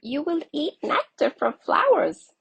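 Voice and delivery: high, loud bumblebee voice; a bright, busy bee; buzzy bumblebee. A narrator's reading. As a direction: coax him with sweet treats. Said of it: "said a buzzy bumblebee".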